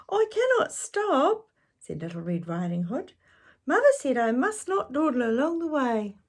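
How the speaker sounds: put-on very high, earnest child; little girl voice. Girl refusing the wolf, high and earnest. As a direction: insist like a good little girl.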